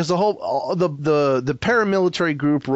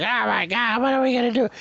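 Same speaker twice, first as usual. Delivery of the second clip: high, hammed-up wail; exaggerated panicky imitation; mock panic; 10 semitones higher than usual. Acts out panicking characters on roof.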